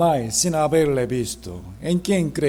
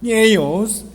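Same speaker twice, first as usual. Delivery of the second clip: slow, louder scripture read aloud together; slow group reading; 2 semitones higher, 4 dB louder than usual. Shown as a demonstration. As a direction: read slowly, a little louder. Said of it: "lentamente leamos juntos".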